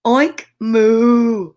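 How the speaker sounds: short oink, long loud moo; pig and cow calls. Acted. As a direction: pop an oink, then stretch the moo. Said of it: "could moo and oink".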